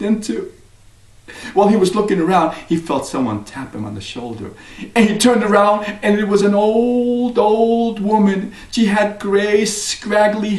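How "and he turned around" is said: plain reading voice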